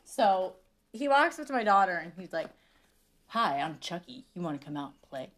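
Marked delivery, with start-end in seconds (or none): 3.3-5.3 low, quiet creepy doll voice; a hint of a sinister doll, spoken low; creepy Chucky. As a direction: drop low and soft to spook a little girl. Kid pretends to be Chucky.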